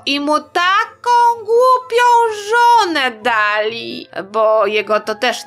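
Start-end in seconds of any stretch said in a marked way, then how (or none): 0.1-4.1 high, drawn-out wail of dismay; exclaimed lament. Acted. Storyteller bemoaning the wife they gave poor Adaś, high drawn-out lament. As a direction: stretch it out high, as if appalled on his behalf. Mock lament over the foolish bride.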